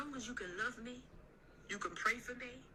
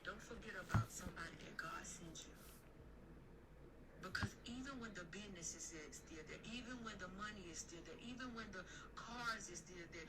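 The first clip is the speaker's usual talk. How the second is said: softer, sincere, almost confiding sermon; hushed preaching; 10 dB quieter than usual. Acted. Hushed counsel to listeners about loving a spouse. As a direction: lean in and plead softly with them.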